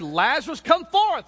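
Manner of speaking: a high, loud command to the dead; commanding call. Acted. Jesus commands Lazarus out of the tomb, loud command. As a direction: call him out of the tomb with authority. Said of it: "God could have said".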